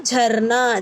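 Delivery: ordinary talk, nothing marked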